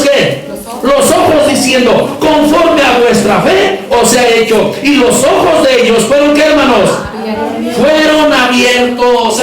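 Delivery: slow, forceful proclamation; preaching. Real, not acted. Preacher telling how blind eyes were opened, forceful preaching. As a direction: draw out key words for the congregation.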